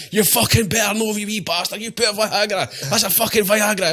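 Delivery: high, sing-song hammed-up rage; high, singing mock outrage at the prankster; mock angry workmate. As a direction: wail it like a wronged pal. Furious workmate after being spiked.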